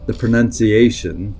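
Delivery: ordinary talk, nothing marked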